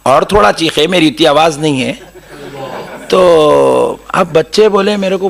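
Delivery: ordinary talk, nothing marked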